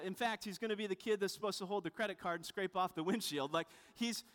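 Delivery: ordinary talk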